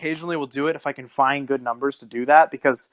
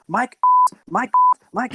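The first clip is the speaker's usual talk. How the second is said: higher, hammed-up repeated name; mock scammer repeating; 3 dB louder than usual. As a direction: imitate the scammer calling the name over and over.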